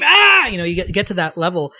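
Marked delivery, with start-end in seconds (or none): 0.0-0.5 high, loud sudden scream. Shown as a demonstration. Actor demonstrating how far screaming goes, high loud scream. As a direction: scream at full voice, pitched way up. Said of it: "the more you get screaming and scream".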